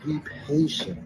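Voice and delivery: soft, slow, soothing words of reassurance; relayed message spoken softly and slowly; gentle divine voice. Retold or imitated. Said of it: "So I received it as".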